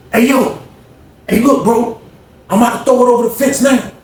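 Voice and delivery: retold call to someone a distance away. Calling out to men across yard.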